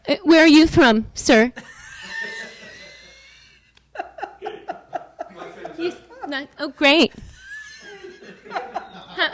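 put-on loud old-school comic, working the room; brash nightclub comic with a mic; old borscht belt comic. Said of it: "You wanna hear [name] do stand-up comedy?".

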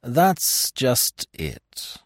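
very slow, drawn-out words; slow admission. A narrator's reading. Narrator confessing he left the door unlocked, slow admission. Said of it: "I said slowly".

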